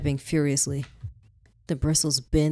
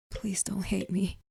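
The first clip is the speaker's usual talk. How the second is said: soft hushed begging; whispered plea; 4 semitones higher, 7 dB quieter than usual. Acted. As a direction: barely breathe the plea. Whispered plea to dead husband.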